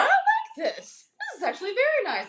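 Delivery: high, laughing, approving voice; mock approving guy. Guys on TikTok trying on girlfriends' dresses, high laughing approval. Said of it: "and they're like".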